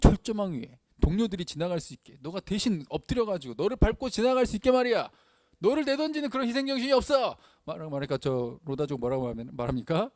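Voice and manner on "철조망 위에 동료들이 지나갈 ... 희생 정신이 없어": impassioned rebuke, rising higher and louder; acted scolding. Movie character berating a comrade over sacrifice, angry scolding. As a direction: build into a raised-voice dressing-down.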